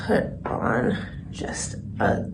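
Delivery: talking while doing mascara, hushed; half-whispered, mouth stretched for mascara; applying mascara. Real, not acted. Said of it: "it's hard to talk while doing mascara".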